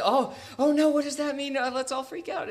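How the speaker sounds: high-pitched, hammed-up alarm; mock panic. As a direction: squeal it in pretend panic. Mocking a panicked overreaction to words.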